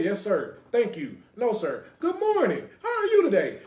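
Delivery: high, polite job-interview voice. Retold or imitated. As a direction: put on the proper office voice, bright and eager. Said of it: "you gotta talk like this".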